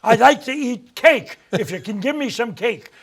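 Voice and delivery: imitating a Dakota diner ordering cake; Midwest accent. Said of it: "give that accent".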